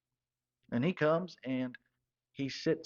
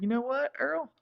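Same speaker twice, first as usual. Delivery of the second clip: much higher, bright mock-cheery question; chipper reply; 12 semitones higher than usual. To Earl after the free rounds, much higher cheerful call. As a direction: perk right up, sing-song.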